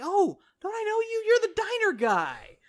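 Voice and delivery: high-pitched lady's voice; imitated delighted recognition. Lady recognizes Healy from the diner, high and surprised.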